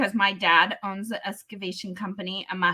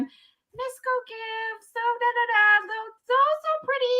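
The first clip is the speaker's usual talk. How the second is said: sing-song; 12 semitones higher than usual. Retold or imitated. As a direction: float it up high like a pageant queen. Showing off her pageant self.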